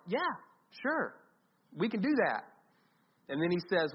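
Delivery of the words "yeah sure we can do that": lightly acted, higher-pitched naive yes; breezy agreement. Disciples answering Jesus without understanding, breezily agreeing. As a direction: agree readily, pitch lifted, as if clueless.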